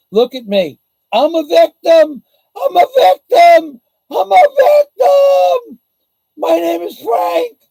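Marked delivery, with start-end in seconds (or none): 1.1-7.6 escalating high shouted self-pity; hammed-up victim shriek, drawn out; mock Frank. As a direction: wail it louder and higher each time. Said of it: "Now I'm gonna become Frank".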